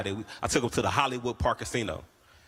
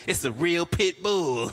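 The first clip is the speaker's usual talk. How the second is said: slow, higher, pushing the claim; mock insisting; 6 semitones higher, 3 dB louder, slower than usual. Seller insisting his dog is real, slow mock insistence. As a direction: sell it hard but slowly.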